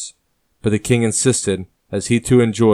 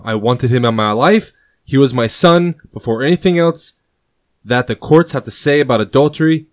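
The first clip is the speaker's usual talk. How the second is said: grieving king, louder and higher, anger rising; raging over a dead son; tragic anger; 5 semitones higher, 6 dB louder than usual. Acted. King mourns his bastard son, in loud grieving anger. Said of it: "he says tragically, as the anger seems to be mounting".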